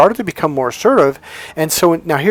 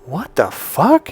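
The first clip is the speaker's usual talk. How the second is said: slow, drawn-out bewilderment; dumbfounded, half-speed reaction; baffled disbelief; 3 semitones higher, much slower than usual. Retold or imitated. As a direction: stretch each word out in retold astonishment. Baffled reaction to wife's change.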